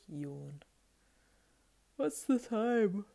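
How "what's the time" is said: sleepily. Acted. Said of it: "he said sleepily".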